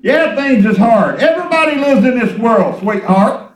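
loud exhortation with stretched words; fervent preaching. Real, not acted. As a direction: drive each phrase home to the congregation. Preaches with full voice.

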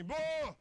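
loud mock cow moo. Cow sneaks up behind the preacher, loud mock moo. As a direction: bellow like a cow surprising you.

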